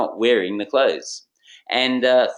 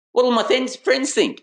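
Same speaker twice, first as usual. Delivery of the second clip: much higher, louder anxious whine; mock worried salesman; 12 semitones higher, 3 dB louder than usual. Salesman worrying about his mates' opinion, hammed-up whine. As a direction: play the fretting cool kid. Hip-hop salesman voiced refusing clothes.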